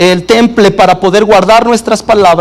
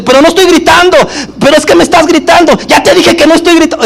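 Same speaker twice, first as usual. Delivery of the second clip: higher, faster, heated mock denial; mock yelling denial; 8 semitones higher, faster than usual. Spouse in an argument denying shouting, higher and fast mock yell. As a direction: insist you're not yelling while yelling. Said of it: "empieza a subir el tono de la voz".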